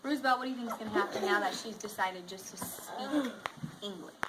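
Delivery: lively read-aloud voice for the class; reading to children. A narrator's reading. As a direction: read the picture book to students. Teacher reads story aloud.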